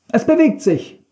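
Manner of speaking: high, excited-sounding narrated call. A narrator's reading. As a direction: call it out, pitched right up. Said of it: "rief er".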